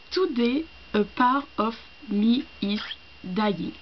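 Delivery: slow English read with a heavy French accent. Shown as a demonstration. Reading a play's English line aloud, in a French accent. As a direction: reads the English line slowly in her self-mocked French accent. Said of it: "mon accent, euh, anglais est tout pourri".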